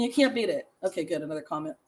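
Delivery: ordinary talk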